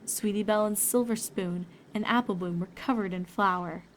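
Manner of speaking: usual speaking voice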